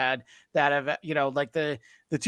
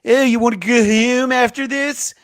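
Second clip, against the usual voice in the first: hammed-up Philly accent, pitched higher and louder; mock Philadelphia accent; 9 semitones higher, 7 dB louder than usual. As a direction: play a loud Philadelphia fan in the stands, higher and louder. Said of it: "with that accent".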